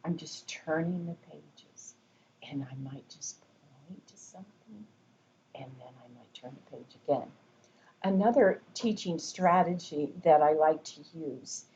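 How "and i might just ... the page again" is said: soft whispered aside; hushed voice. Shown as a demonstration. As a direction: drop to a hush while pointing. Hushed demo of quiet pointing.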